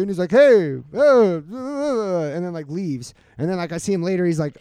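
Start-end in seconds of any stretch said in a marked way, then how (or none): 0.3-2.3 drawn-out, higher, mocking weirdo greeting; imitated weirdo. Storyteller mimics the weirdo at the bar, spaced-out mockery.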